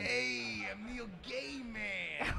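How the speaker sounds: slow, lightly voiced Neil Gaiman bit; a put-on slow character intro. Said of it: "his name is, uh, Neil Gaiman".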